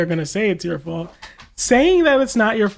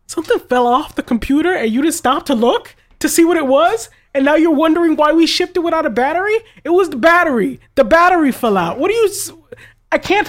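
retold rant, higher and louder, with rising disbelief; exasperated disbelief; 9 semitones higher, 4 dB louder than usual. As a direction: replay your outrage at the caller.

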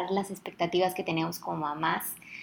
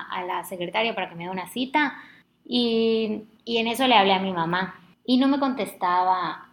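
normal talking voice

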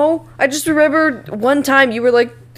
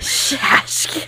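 whispered silly call; breathy goofy comedy voice; Adam Sandler voice; 4 semitones higher, 4 dB quieter than usual. Pretend. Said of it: "We're not doing Little Nicky again".

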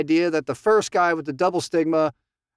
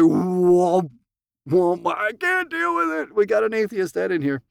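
mock alarmed whoa. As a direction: play a shocked member backing away. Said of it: "It's like".

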